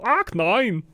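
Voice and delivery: high, over-the-top German dismay; comical Reinhardt. Acted. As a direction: camp up the German wail. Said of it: "going steadily more comical".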